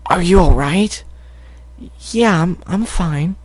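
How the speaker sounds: put-on high young filly voice. Filly asking her hurt friend, high voice. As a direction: play the small filly.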